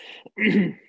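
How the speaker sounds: real coughing after water went down wrong; coughing fit. Real, not acted. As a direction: cough hard to clear the throat. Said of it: "glass of water went down the wrong way".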